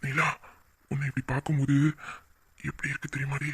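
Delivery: low, soft, intimate sweet talk; tender romantic murmur. Acted. Lover praising her blushing face, tender murmur. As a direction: murmur it low and close, admiringly.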